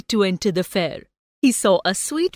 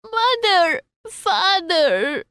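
put-on high, drawn-out child's wail; desperate wail; 9 semitones higher, much slower than usual. A narrator's reading. Lost child calls for his parents, high desperate wail. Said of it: "he wailed desperately".

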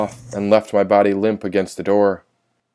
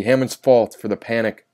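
usual speaking voice

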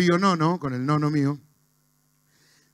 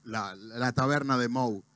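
normal talking voice

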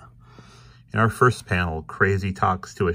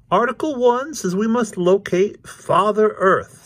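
very high, loud proclaiming cat; Krazy Kat; 14 semitones higher, 5 dB louder than usual. A narrator's reading. Krazy proposes articles to Ignatz, high proclaiming voice. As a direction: announce it grandly way up high.

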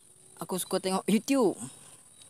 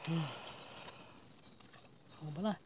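ordinary delivery

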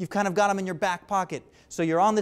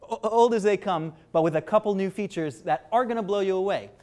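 ordinary talk, nothing marked

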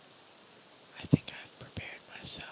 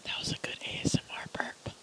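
whispering. Real, not acted. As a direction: whisper close to the mic. ASMR eating video.

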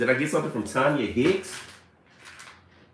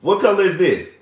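proud quiz; 6 semitones higher than usual. Real, not acted. Quizzing toddler on colors.

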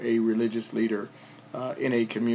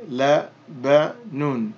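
"la ba nun" slowly, one syllable at a time; 4 dB louder, much slower than usual. Shown as a demonstration. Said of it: "So we would say".

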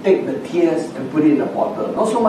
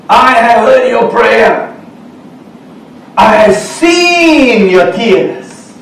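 slow, loud, high declaration from God; emphatic proclamation; 5 semitones higher, 5 dB louder, much slower than usual. Acted. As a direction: draw out SEEN high and loud, falling onto "your tears". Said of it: "I like to emphasize the part".

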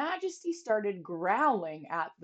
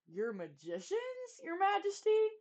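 frightened question; 10 semitones higher than usual. Acted. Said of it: "Bartholomew shivered".

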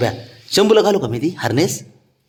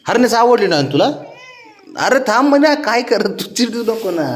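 much higher, sing-song coaxing to the pet; high coaxing pet talk; 8 semitones higher than usual. Real, not acted. Owner coaxing the dog about its harness, high sing-song pet talk.

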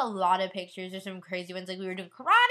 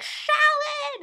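much higher, louder teasing call; group shout; 6 dB louder than usual. Retold or imitated. Friends at pickup teasing her, calling out loud and much higher. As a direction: yell it out to tease her. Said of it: "everybody was like".

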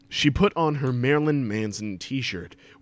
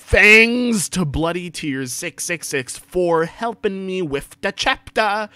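hammed-up author's note; 8 semitones higher, 7 dB louder than usual. A narrator's reading. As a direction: read the misspellings big and overblown.

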